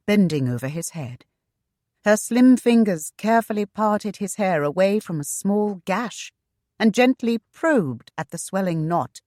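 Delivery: plain reading voice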